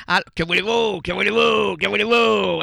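high, loud repeated shout; a hammed-up shout at the audience; mock shout. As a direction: belt it out like a showman.

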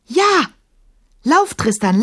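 high, loud urgent cry; shout. A narrator's reading. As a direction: yell at the dog to flee. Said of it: "schrie Emma".

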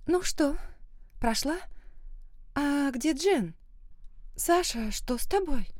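put-on high girlish voice, turning worried; high-pitched worried girl; bright girl voice. A narrator's reading.

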